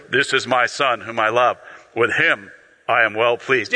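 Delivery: very deep, measured divine proclamation; voice of God. Acted. God speaking from heaven over his Son, deep voice. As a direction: pitch it right down, solemnly. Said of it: "I'm gonna imagine it's deeper".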